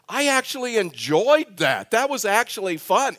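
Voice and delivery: pleasantly surprised. Retold or imitated. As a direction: imitate someone surprised they had fun, drawing words out. Acting out surprised first-time visitor.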